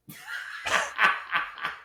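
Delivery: very high burst of laughter; real, uncontrolled high cackle; shrieking laugh. Real, not acted. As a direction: crack up in a high squeal. Cracking up at draft banter.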